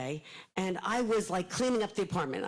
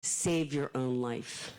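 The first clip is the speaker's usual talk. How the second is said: slow, low, drawn-out ghostly warning; mysterious spirit voice; 6 semitones lower, much slower than usual. Retold or imitated. A spirit guide warns her from the closet in a slow, low voice. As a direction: intone it like a spirit guide from beyond. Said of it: "The voice said".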